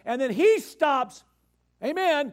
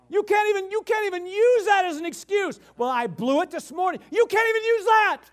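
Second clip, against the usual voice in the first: high, loud insistence; fiery rebuttal from the pulpit; emphatic preaching; 8 semitones higher, 4 dB louder than usual. Real, not acted. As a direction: drive the point home hard. Preacher rebuking excuses.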